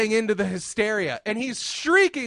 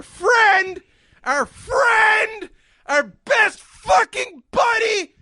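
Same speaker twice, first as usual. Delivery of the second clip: much higher, loud hype welcome; excited shouted greeting; 8 semitones higher, 4 dB louder than usual. Real, not acted. Welcoming their best buddy Pete Bergen, hyped shouting.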